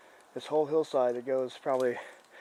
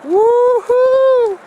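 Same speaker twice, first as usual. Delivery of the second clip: loud, high joyful whoop; excited whoop; 14 dB louder than usual. Real, not acted. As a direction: shout it out with joy. Jumping onto the big rock.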